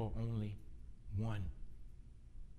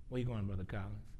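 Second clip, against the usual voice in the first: ordinary talk, nothing marked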